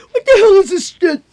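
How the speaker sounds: high, loud imitated tirade; mock outburst. Lawyer to secretary bringing in papers, loud mock outburst. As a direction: explode like a crazy lawyer.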